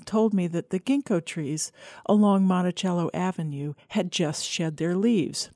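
ordinary delivery, nothing marked

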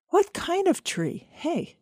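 high-pitched mock protest; mock exasperation. A narrator's reading. Pretend challenge to crank caller.

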